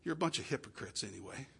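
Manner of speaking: dismissive scoff. Retold or imitated. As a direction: brush the church off as hypocrites, quietly. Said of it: "you know what the world says?".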